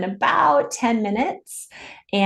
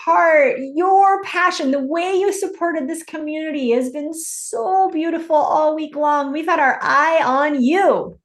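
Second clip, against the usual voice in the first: gushing excitement; 9 semitones higher than usual. Real, not acted. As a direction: gush over her, thrilled. Host gushing, high excited praise.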